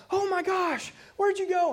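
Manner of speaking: high, mock-puzzled parent calling; mock surprise. Parents faking they can't see the little girl, high mock surprise. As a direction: pretend you can't find the child, playing it up. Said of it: "they're like".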